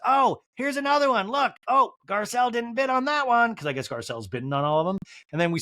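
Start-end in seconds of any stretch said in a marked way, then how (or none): 0.0-3.5 high, eager mock voice; Kyle. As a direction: pipe up in a higher impersonation. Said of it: "Kyle goes".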